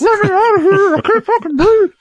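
very high panicked squeak; trapped man voice. Acted. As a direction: squeal like someone trapped in a belly. Man inside Pony's stomach.

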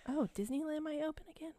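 quick excited whisper. Real, not acted. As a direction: whisper in delighted surprise. Excited whisper while reading list.

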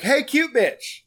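high, cheeky pickup line; guy greets the girl, high and cocky; cocky greeting.

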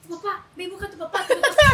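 a retold mother calling out, building to a shout; mom yelling. Mom orders her daughter to fetch dad, yelling.

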